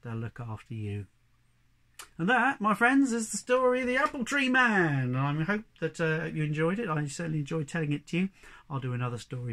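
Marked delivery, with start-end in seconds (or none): 2.2-5.1 high, loud closing announcement; grand storyteller sign-off. Real, not acted. Storyteller closing the tale for friends, ringing proclamation. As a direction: proclaim the title to end the tale.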